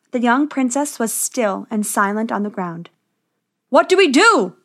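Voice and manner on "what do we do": higher, louder frantic cry; panicked cry. A narrator's reading. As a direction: cry out in alarm over the princess. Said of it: "they cried".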